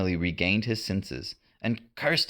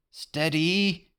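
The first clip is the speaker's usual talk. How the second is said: wavering voice; 9 semitones higher than usual. A narrator's reading. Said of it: "John's voice wavered".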